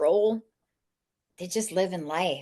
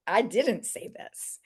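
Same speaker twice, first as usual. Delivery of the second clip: mock-innocent protest; 3 semitones higher, slower than usual. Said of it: "And I was like".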